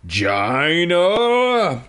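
drawn-out rising yell of a name; mock shriek, stretched long. A narrator's reading. Willa finding her room wrecked, shrieking for Gina. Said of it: "she shrieked".

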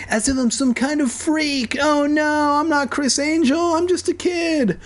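high-pitched, hammed-up panicking kid voice; panicked kid. A narrator's reading. Boy realizing everyone stares at him, high mock-panicked wail. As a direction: wail it in a squeaky, over-the-top panic.

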